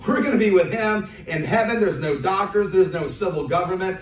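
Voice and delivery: preacher shouting good news of heaven; rousing preaching. Acted. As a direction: lift the crowd with glory. Preacher rises to rousing climax.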